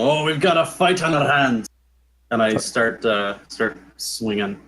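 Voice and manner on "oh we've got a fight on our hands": higher-pitched battle yell, moderately loud; in-character yell. Acted. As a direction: call the party to a fight, half-shouted. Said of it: "immediately yell".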